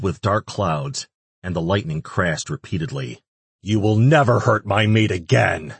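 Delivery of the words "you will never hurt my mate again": loud menacing growl. A narrator's reading. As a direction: threaten them with a growl. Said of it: "he growled at them".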